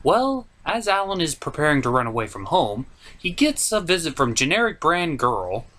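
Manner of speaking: ordinary talk, nothing marked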